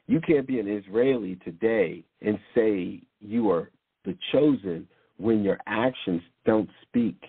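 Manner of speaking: ordinary talk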